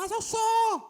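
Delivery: hammed-up stubborn sister repeating herself; whiny sister insisting. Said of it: "Eu falei". (irmã teimosa imitada com voz bem aguda e chorosa)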